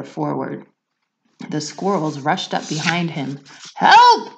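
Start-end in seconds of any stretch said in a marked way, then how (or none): 3.8-4.3 very high, loud cry; father shouting for help as he topples. Acted. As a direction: shout it as you fall. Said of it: "he shouted".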